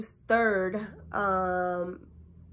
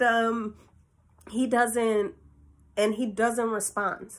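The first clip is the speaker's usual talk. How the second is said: ordinary talk, nothing marked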